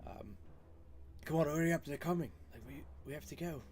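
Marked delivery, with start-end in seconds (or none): 1.2-3.6 urgent hushed yell from the rooftop; whisper yell. Acted. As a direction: call down, hushed but urgent. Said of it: "I'll, like, whisper yell".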